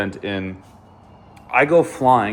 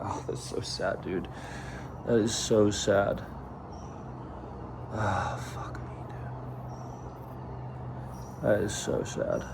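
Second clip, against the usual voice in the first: real hushed heartache, with long pauses; getting emotional; 3 semitones lower, 12 dB quieter than usual. Real, not acted. Upset over the crash, quietly getting emotional. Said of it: "getting emotional".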